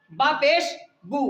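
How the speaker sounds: ordinary delivery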